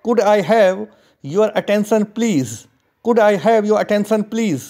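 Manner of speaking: very slow, careful model reading; slow model sentence. Shown as a demonstration. Teacher modelling the request slowly for students. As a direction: read it slowly for learners. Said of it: "इसे English में कहेंगे".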